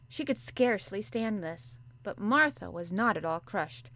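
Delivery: usual speaking voice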